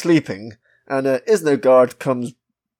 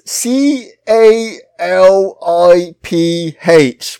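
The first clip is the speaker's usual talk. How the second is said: slow, raised letter-by-letter spelling; spelling out slowly; 4 semitones higher, 5 dB louder, much slower than usual. Real, not acted. Puzzled reader to co-hosts, spelling it out slowly. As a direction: draw out each letter separately.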